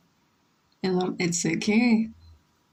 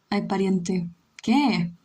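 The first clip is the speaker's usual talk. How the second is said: ordinary talk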